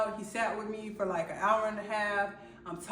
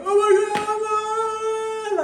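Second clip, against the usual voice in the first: much higher, louder panicked shriek; panicked cry; 13 semitones higher, 11 dB louder, slower than usual. Retold or imitated. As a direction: squeal it out in a panic. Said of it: "I'm panicking".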